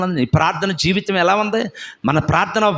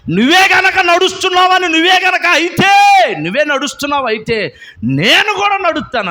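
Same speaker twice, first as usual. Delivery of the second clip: higher, louder excited disciple; eager Peter; 13 semitones higher, 6 dB louder than usual. Acted. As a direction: dare him excitedly. Peter challenging Jesus.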